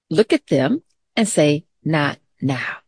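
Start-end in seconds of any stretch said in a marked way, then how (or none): 1.8-2.8 gentle and softer, settling down at the end; calm voice. Shown as a demonstration. Teacher showing kids how to brush off a distraction, calm and soft. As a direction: look at them and say it evenly, never mean. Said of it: "Say in a calm voice".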